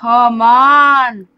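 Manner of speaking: loud, drawn-out groan of frustration; frustrated gamer's cry; exasperated urging. Real, not acted. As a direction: stretch it out loudly, urging impatiently. Frustrated with the game.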